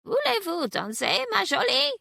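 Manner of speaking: high, coy parrot voice, rising; flirtatious parrot. Acted. The parrot flirts with Celeste, inviting her to dance in a high parrot voice. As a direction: squawk it up high like a flirting bird. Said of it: "replied Señor Rodrigo".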